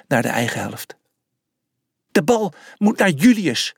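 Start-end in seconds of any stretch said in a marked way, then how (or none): 2.1-3.8 called out. A narrator's reading. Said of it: "riep Sunuxia vanaf de zijlijn".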